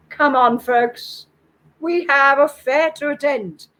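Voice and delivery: loud, high rallying call. A narrator's reading. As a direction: hail the whole boat to get going. Bokuden calls out to boat passengers.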